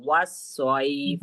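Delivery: وصیف very slow, with a thick, heavy saad; teacher demonstrating a heavy saad in وصیف. Shown as a demonstration. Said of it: "ہمیں موٹا پڑھنا ہے اس کو".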